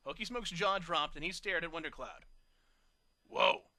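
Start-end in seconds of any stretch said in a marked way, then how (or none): 3.3-3.7 breathless. A narrator's reading. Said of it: "he breathed breathlessly".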